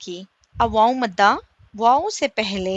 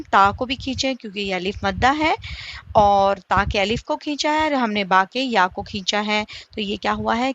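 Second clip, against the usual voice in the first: normal talking voice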